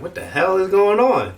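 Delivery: can't believe it, what the hell; incredulous. Retold or imitated. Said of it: "so I'm just like".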